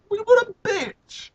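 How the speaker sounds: high slow scandalized jab; mock-outraged aside. Real, not acted. Reader jokingly insulting Frida, high and slow. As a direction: drawl the insult in mock shock.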